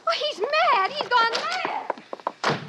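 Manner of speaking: high, slowing fearful words; frightened cry. Acted. Lenina fleeing John in fear of his madness. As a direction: flee in alarm.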